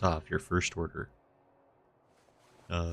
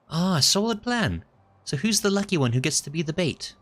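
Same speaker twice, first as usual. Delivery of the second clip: cheery companion voice; 10 semitones higher, 5 dB louder than usual. Acted. NPC cheerful then wary.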